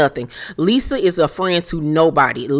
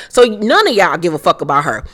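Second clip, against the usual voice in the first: usual speaking voice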